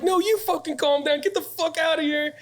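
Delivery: very high, strained furious yell; screaming back. Retold or imitated. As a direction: throw it right back at them in a rage. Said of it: "screaming at these guys".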